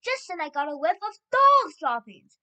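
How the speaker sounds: loud, high-pitched yelled report; witch's yell. A narrator's reading. Witch telling the others what she sniffed, yelling. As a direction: yell it, hitting the last words hard. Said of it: "she yelled".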